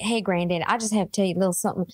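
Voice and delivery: lightly done Southern belle telling granddad; mock Southern belle. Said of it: "speaking like this".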